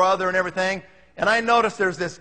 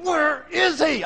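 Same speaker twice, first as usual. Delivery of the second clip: higher, slow exasperated wondering; impatient thought; 9 semitones higher, much slower than usual. Retold or imitated. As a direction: stretch it out, fed up with waiting. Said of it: "And I'm thinking".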